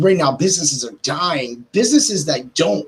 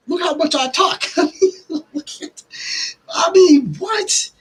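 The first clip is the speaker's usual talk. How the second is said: laughing; 13 semitones higher, slower than usual. Real, not acted. Laughing at own chattiness.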